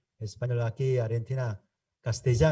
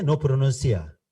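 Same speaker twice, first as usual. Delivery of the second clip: ordinary talk, nothing marked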